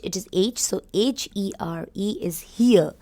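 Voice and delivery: ordinary delivery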